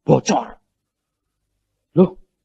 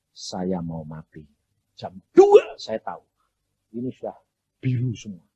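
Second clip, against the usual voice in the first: ordinary talk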